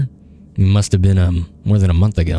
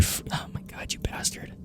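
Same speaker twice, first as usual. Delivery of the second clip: exasperated whisper; 19 dB quieter than usual. Real, not acted. Furious at stubborn game character.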